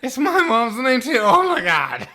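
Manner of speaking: loud, high, hammed-up astonishment; over-the-top excited shouting; mock overjoyed Batman. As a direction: yell it like an amazing discovery. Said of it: "Batman's just like".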